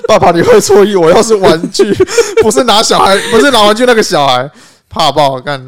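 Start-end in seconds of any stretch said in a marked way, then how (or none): 0.1-4.5 put-on child protesting. Child voice correcting father.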